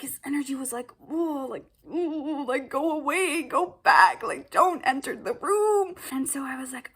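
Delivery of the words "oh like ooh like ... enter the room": higher and louder, shooing me away; menacing brush-off voice. Retold or imitated. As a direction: voice his hostile vibe loudly. Voicing his hostile energy.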